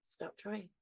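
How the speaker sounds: hushed inner voice, very soft; whisper. Retold or imitated. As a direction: imitate a quiet voice heard in meditation. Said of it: "in the whisper, the voice says to me".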